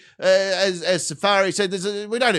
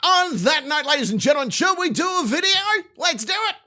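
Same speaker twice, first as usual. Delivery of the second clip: hype announcer; 10 semitones higher than usual. Real, not acted. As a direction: pump up the crowd like a ringmaster. Hyping up the stream audience.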